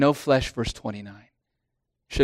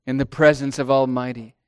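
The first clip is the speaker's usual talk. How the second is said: ordinary talk, nothing marked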